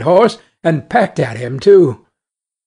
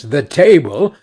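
plain reading voice

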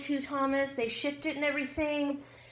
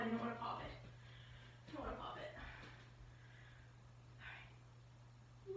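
whispering; 4 semitones lower, 21 dB quieter than usual. Real, not acted. Hushed over the balloon.

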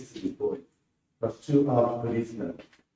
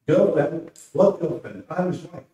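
indignant; 6 semitones higher, 4 dB louder than usual. Acted. Wife indignant at insult.

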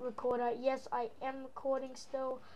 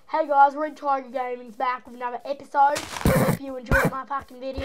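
loud, hammed-up broad Australian greeting; mock Aussie YouTuber welcome; full Aussie intro; 10 dB louder than usual. Shown as a demonstration. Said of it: "if I was an Aussie".

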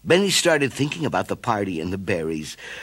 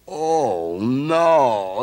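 dismayed moan; 3 semitones higher, 4 dB louder than usual. A narrator's reading. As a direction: groan at the mistake. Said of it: "he moaned".

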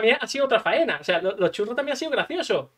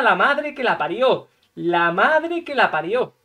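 slow drawn-out curse; slower than usual. Retold or imitated. Cursing the doctor over swollen arm.

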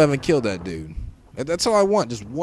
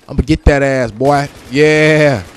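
loud trash-talk yell at the enemy; yelling in the heat of the fight; hyped shout; 2 semitones higher, 8 dB louder than usual. Real, not acted. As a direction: bark it out, taunting the kill. Taunting an enemy in a shootout.

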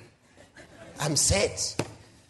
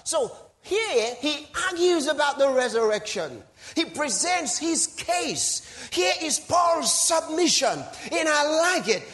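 full-force preaching; 8 semitones higher, slower than usual. Acted. Preacher hailing Paul's argument to the church, full-force preaching. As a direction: preach it like a closing argument, slowing on the big words.

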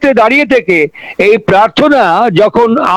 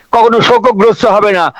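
ordinary talk

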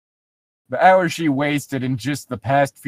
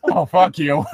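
laughing; 5 semitones higher than usual. Real, not acted. Laughing after red-green joke.

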